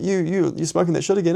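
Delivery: high pointed accusation; accusing. Retold or imitated. Man confronting roommate about relapse, high accusing.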